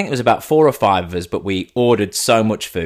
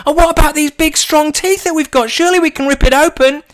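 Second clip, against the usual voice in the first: very high, loud squeaky cub voice; an eager little cub pleading with dad; baby fox cub; 5 dB louder than usual. Acted. Baby cub begging dad to claw through the cling film, squeaky and high. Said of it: "other little baby cubs".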